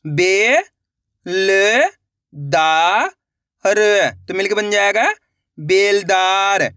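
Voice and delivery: each syllable punched out alone, then the word drawn out slowly; slow sounding-out demo. Shown as a demonstration. Teacher leading students to repeat syllables, slow and emphatic. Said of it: "देखो किस तरीके से".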